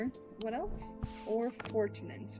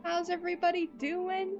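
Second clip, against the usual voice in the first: high excited squeals; bubbly, high greeting voice; cute anime streamer; 11 semitones higher, 4 dB louder than usual. Real, not acted.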